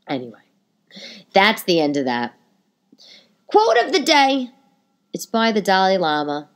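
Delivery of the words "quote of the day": high, loud segment announcement; a podcast host's bold segment call; announcer intro. Real, not acted. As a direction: announce it like a show jingle.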